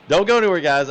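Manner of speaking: hurried laughing reminder to chat; rushed repeat. Real, not acted. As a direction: rattle it off quickly as you leave. Streamer repeats sign-off, laughing.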